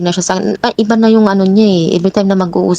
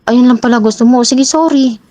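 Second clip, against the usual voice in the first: imitated grudging sorry, pitched up; mock-conceding, higher than usual; insincere apology; 5 semitones higher, 3 dB louder than usual. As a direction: toss out a sorry you clearly don't mean. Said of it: "Parang hindi siya sincere".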